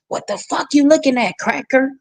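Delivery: mock the mother snapping at a stranger; hammed-up rude mother jeer; imitating mama's insult. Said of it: "She was like".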